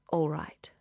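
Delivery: a breathy whispered all right; hushed reluctant consent. Acted. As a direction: whisper it, giving in. Lovers agree in secret.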